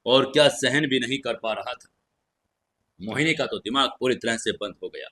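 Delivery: ordinary delivery, nothing marked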